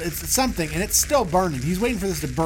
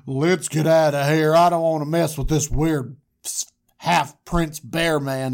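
lightly voiced shepherds, louder; retreating shepherds; 4 dB louder than usual. Shepherds fleeing the weird bear man, lightly acted and loud. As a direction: play put-out locals backing off. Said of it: "And they go".

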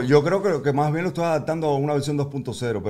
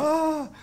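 mock toddler cry. Dad voicing toddler crying out.

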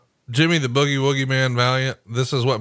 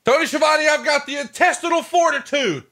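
mock wrestler. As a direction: ham up the wrestler's line. Mocking wrestler catchphrase.

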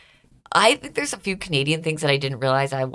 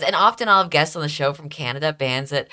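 usual speaking voice

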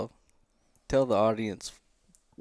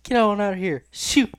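much higher, yelled; mock the hunter scaring off the bear; mock shooing shout; 8 semitones higher than usual. Friend mimicking how the hunter yelled at the bear.

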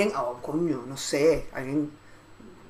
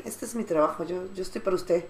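softer, formal butler; a hint of the devoted butler speaking; lightly voiced butler; 9 dB quieter than usual. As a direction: butler quietly insisting on staying. Butler answers, she imitates.

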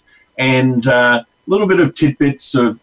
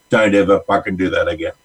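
low, quick, controlled threat, acted out; mock stern warning; 7 semitones lower, faster than usual. Counsellor acting out a woman warning off her brother-in-law, stern. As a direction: warn them off in a lowered voice, no shouting.

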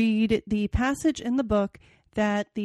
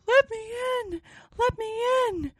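put-on much higher, pleading ghost-child voice; sobbing ghost child; 13 semitones higher than usual. Ghost girl begging at the window, high mock sob. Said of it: "a most melancholy voice sobbed".

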